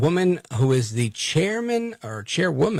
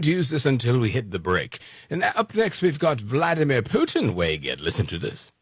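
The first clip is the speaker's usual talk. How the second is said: mock British accent. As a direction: tease the viewers in a hammed-up British accent. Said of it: "now I'm stuck in this voice".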